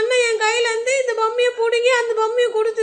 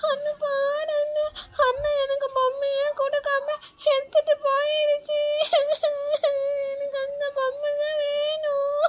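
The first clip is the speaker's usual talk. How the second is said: put-on whimpering child; 4 semitones higher than usual. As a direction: whimper the complaint through tears. Child complains crying to brother. (அண்ணனிடம் அழுது முறையிடும் சிறுமி, மெல்லிய விசும்பல்)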